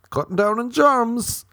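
high, loud silly boast; mock proclamation. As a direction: belt it out like a daft superhero doctor. Mock boast of being a doctor.

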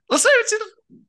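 high flustered squeak; voice went up. Real, not acted. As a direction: voice shoots way up in protest. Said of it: "the way your voice just went up".

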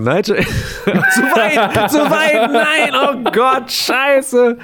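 high-pitched laughing cry; laughing panic. Real, not acted. Technical mishap, laughing panic.

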